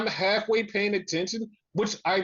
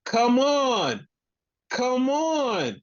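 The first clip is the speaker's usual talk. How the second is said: higher, drawn-out mock call; imitating Cash; 6 semitones higher, much slower than usual. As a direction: imitate a wrestler calling out to someone. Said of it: "and he goes".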